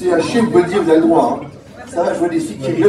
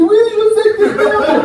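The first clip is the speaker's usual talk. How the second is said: much higher, louder mock mother voice; mother's high mock agreement; 14 semitones higher, 5 dB louder than usual. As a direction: squeak out the mother's blunt answer. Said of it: "Elle m'a dit".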